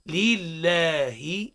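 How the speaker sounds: "لله" very slow, with a clear kasra, doubled lam and long a; slow, articulated "لله". Shown as a demonstration. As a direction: spell out "لله" slowly for repetition. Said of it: "هاك تواصل". (نطق متأنٍّ لكلمة لله)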